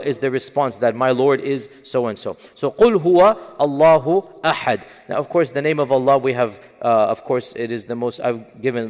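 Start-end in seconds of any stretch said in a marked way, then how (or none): 2.7-4.8 reciting Quran; Quran recitation. Acted. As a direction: recite the verse louder, with weight. Recites the verse again.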